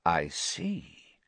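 low, soft, cold reply; quiet master weighing it up; breathed softly. A narrator's reading. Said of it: "breathed the second voice softly".

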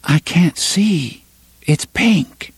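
high, slow puzzled trance voice; lost inside a memory; dreamy young woman. Acted. Susan in trance.

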